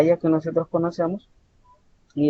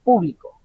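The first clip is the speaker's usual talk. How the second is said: PÚ-blico, higher start and a fall; stress on first syllable; 3 semitones higher than usual. Shown as a demonstration. Teacher showing the tonic accent, first syllable of "público" stressed. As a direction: punch the PÚ of "público". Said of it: "en la primera sílaba".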